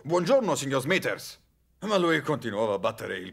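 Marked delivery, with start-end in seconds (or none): 0.0-1.4 retold first attempt at a shout, higher at the start; mild retold shout. Bert recalls greeting his grumpy neighbour over the hedge, mild shout. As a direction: call out a greeting, moderately raised. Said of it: "Gli ho urlato".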